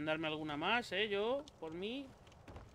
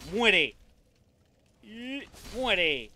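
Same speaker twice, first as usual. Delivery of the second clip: loud fierce yell; battle shout; 4 semitones higher, 12 dB louder, much slower than usual. Real, not acted. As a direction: yell as you strike. Attacking an enemy.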